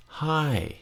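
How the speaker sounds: High starts high and falls; high falling tone. Shown as a demonstration. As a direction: drop High from high. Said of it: "is falling".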